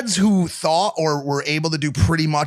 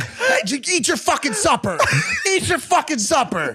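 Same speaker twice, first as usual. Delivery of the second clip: high, laughing mock gruff father; lightly voiced injured dad, much higher; dad brushing it off; 8 semitones higher than usual. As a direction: wave off the injury, eat your supper. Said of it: "He's like".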